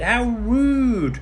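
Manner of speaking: high, loud indignant sniff; jealous sneer. A narrator's reading. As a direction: scoff like a jealous rival. Jealous maidens gossip.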